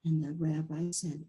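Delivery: ordinary delivery, nothing marked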